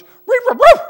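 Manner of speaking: high, loud yappy barking; mock dog bark. A pastor playing the preaching dog, barking high and loud. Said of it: "and the dog goes".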